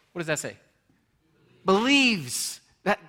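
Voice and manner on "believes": high, loud single word Believes; emphatic. Real, not acted. Preacher stressing Believes so the congregation gets it. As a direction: land Believes hard and loud. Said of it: "please don't walk away without understanding this".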